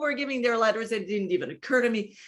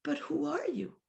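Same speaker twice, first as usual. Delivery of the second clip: higher, soft and sweet; hushed sweet question, higher; 6 semitones higher, 7 dB quieter than usual. Retold or imitated. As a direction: ask tenderly, like a loving inner voice. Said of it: "the voice said sweetly".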